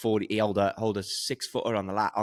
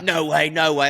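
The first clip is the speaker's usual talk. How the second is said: louder, excited no-way disbelief; 6 semitones higher, 7 dB louder than usual. Retold or imitated. Lads doubting the hole in one, loud excited disbelief. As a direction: protest it can't be true.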